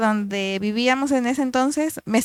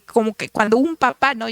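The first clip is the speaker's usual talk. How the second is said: ordinary delivery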